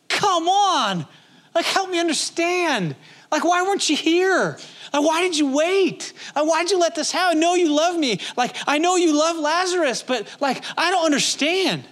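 grieving sister pleading why did you wait; pleading Martha. Retold or imitated. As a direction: beg him to explain why he waited. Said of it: "I gotta feel like that's a".

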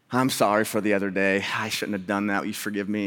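low, subdued acted apology; contrite apology. Pastor apologizing to his neighbor, retold contrition. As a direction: ask forgiveness humbly, voice dropped.

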